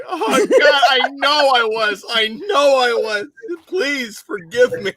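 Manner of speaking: high-pitched, laughing confession; high, helpless mock pleading; laughing plea. Real, not acted. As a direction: beg forgiveness through giggles. Mock pleading after being roasted, laughing.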